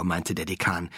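ordinary delivery, nothing marked

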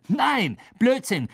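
high, loud, dismissive exclamation; calling out. A narrator's reading. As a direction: cut in with a heated rebuttal. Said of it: "rief Ron".

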